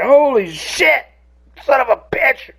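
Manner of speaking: high-pitched, drawn-out yelp of alarm; mock panicked high outburst; retold startled cussing. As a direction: re-enact jumping at a snake, voice shooting way up. Retold fright at a snake.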